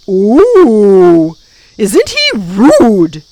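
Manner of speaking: loud, drawn-out mock shock; delighted creature hollering in pretend outrage; screaming gaboon. A narrator's reading.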